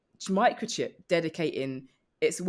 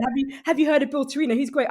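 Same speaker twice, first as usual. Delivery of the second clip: gushing fan; 8 semitones higher, 6 dB louder, faster than usual. Retold or imitated. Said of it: "reading that book going".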